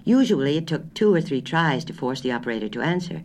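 ordinary delivery

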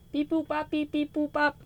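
high, soft robot beeping; toy robot voice. Pretend. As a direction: beep like a little toy robot. Mock confirming with her computer.

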